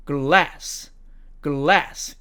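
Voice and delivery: American "glass", front a; American demo of "glass", short a; American accent. Shown as a demonstration. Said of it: "미국식 발음으로는".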